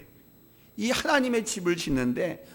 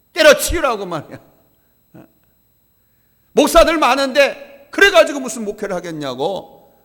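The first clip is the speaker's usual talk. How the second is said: angry scolding; 2 semitones higher, 5 dB louder than usual. Retold or imitated. Senior pastor berating a trainee minister, angry scolding. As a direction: imitate a mentor laying into a struggling pupil. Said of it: "화를 내시면서 야단쳤습니다".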